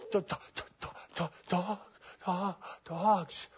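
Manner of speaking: whispered stuttering on "dog"; breathless mock stutter; frightened stammer. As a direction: stammer it out like someone too scared to speak. Imitating stammering caller.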